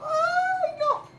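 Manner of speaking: very high, loud wail; real dismayed scream. Real, not acted. Player losing his careful shot, high scream. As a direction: scream out as it goes wrong.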